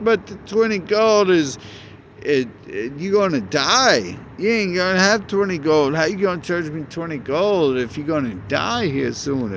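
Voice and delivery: hammed-up frustration with a stubborn NPC; mock exasperated argument. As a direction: player ranting at an innkeeper, half-joking. Arguing with stubborn innkeeper NPC.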